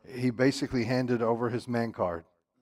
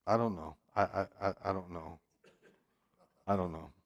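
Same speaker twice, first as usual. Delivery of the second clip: soft, low, hesitant mumble; stammering; 6 semitones lower, 11 dB quieter than usual. Acted. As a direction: fumble for words, trailing away. Samson stammering for an answer.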